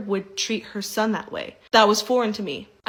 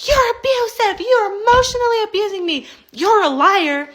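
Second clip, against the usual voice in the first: much higher, louder accusing shriek; mock screaming mother-in-law; 12 semitones higher, 7 dB louder than usual. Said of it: "she's screaming at me".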